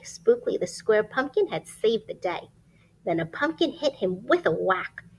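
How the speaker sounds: lively rhyming storybook read; reading to children. Acted. Reading a rhyming picture book aloud to children. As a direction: read it like bedtime story time.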